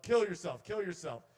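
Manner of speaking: loud, high taunting order; intrusive thought command. Acted. Intrusive thoughts order the comedian around, loud high command. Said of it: "they would always tell me".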